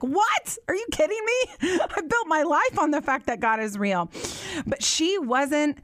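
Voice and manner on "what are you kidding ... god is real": high-pitched, laughing disbelief at an absurd question; incredulous disbelief. Retold or imitated. Mother answering her daughter's doubt, pitched-up incredulous disbelief. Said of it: "for me, I'm like".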